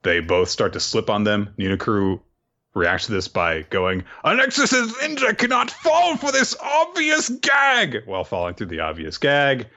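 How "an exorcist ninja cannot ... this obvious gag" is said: much higher, hammed-up heroic boast; bold proclamation. Slipping on beans, a much higher hammed-up heroic boast. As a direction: proclaim it grandly as you slip. Ninokuru proclaiming while falling.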